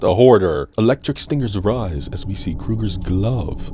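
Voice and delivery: ordinary delivery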